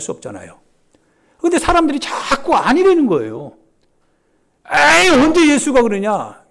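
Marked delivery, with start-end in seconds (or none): 4.7-6.4 high, loud scoffing retort; acted doubter's protest; dismissive objection. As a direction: wave it off with a raised voice.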